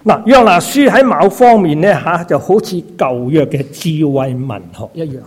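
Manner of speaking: ordinary delivery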